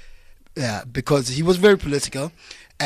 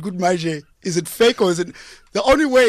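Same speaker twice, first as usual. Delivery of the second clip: ordinary talk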